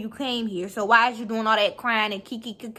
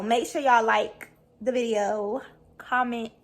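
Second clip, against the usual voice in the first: ordinary talk, nothing marked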